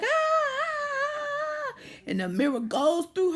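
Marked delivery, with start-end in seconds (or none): 0.0-1.7 very high, crying screams; screaming. Retold or imitated. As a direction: let out piercing screams of terror. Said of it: "And they scream like".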